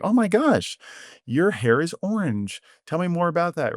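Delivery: high, bright oh my gosh, then keen interest; delighted curiosity. Retold or imitated. Mentor answering a kid's orange hair with delight. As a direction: gush with interest at the news. Modelling a calm, interested reply.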